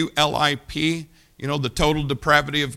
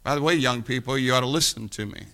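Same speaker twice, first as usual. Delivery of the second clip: usual speaking voice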